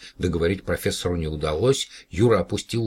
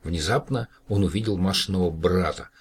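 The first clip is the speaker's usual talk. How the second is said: ordinary delivery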